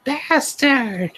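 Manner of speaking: squeaky falsetto yell, hammed-up; mock angry shout. A narrator's reading. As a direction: shriek the insult in a mock fury. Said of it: "I shouted angrily".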